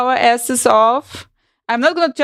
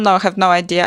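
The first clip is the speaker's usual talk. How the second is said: usual speaking voice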